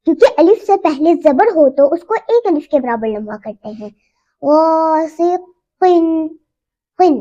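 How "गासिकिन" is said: गासिकिन very slowly, with a heavy gh and the a held one alif long. Shown as a demonstration. Teacher shows the long heavy vowel in गासिकिन. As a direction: stretch the first vowel of गासिकिन. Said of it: "एक अलिफ के बराबर लंबा करेंगे".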